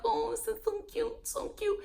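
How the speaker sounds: high, soft cooing; gushing coo. Retold or imitated. Host replaying her reaction to a cute date, high gushing coo. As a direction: coo softly as if at something adorable.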